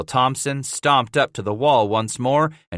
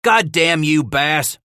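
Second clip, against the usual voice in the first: raised angry curse; growled curse; 7 semitones higher, 5 dB louder, slower than usual. A narrator's reading. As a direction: spit the curse at Bass. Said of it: "One of the trappers growled".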